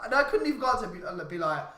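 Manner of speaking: ordinary talk, nothing marked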